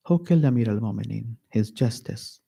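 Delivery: ordinary talk, nothing marked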